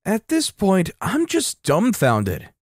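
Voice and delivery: ordinary delivery, nothing marked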